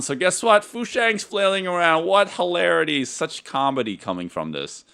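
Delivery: high-pitched mock enthusiasm, falling away at the end; sarcastic. Shown as a demonstration. Host mocking the film's flailing doll comedy, sarcastic. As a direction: feign delight at lame slapstick. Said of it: "I say that with, like, a bit of sarcasm".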